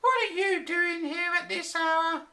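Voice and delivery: high-voiced nagging wife; angry wife. A narrator's reading. Wife scolding the professor for working late, high and cross. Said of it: "his angry wife".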